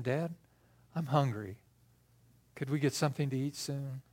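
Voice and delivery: a hint of a child, low and very soft; quietly imagine a small request; softly voiced boy. Healed boy asks his dad, soft and tender. Boy speaks to father walking home.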